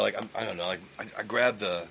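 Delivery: ordinary talk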